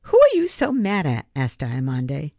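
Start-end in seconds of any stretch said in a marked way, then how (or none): 0.0-1.3 high, loud, probing question; pointed demand. A narrator's reading. Girl confronting the angry new boy, high and loud. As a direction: dig for the truth hard.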